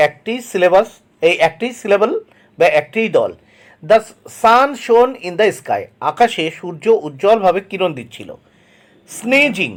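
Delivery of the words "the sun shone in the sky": very slow, word by word; slow dictation reading. Shown as a demonstration. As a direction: read it out slowly for the class to copy. Teacher reads example sentence aloud. (ধীরে ধীরে শ্রুতলিখনের মতো পড়া)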